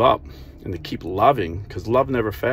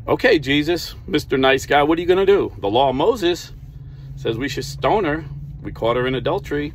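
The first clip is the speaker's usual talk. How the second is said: challenging Pharisees; 6 semitones higher than usual. Retold or imitated. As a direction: put Jesus on the spot, mockingly.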